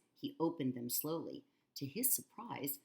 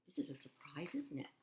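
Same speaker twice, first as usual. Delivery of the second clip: whisper; 7 dB quieter than usual. Real, not acted. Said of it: "whispered aside".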